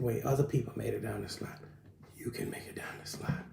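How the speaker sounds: soft reassuring whisper; tender whisper. Retold or imitated. Father reassuring frightened daughter at the slide, gentle whisper. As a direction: whisper it to calm a scared child.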